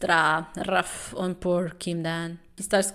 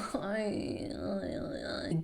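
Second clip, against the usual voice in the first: soft whispered laugh; 10 dB quieter than usual. Real, not acted. Laughing at the manhwa.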